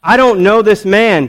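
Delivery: loud, high, slow protest; emphatic denial. Acted. Peter denying Jesus a second time, loud and emphatic. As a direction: spell the denial out slowly and forcefully.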